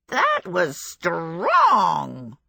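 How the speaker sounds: very slow strange crackling voice; fire demon voice. A narrator's reading. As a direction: drag it out, dazed. Calcifer reacts to flame.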